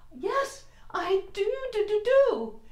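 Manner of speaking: high, stammering with cold; shivering stutter. Acted. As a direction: teeth chattering yes. Penguin snaps beak stammering.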